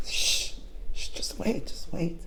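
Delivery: soft whispered calming; hushed, gentle urging to be patient; hushing. Acted. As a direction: shush so the seeds aren't scared. Frog hushing Toad for seeds.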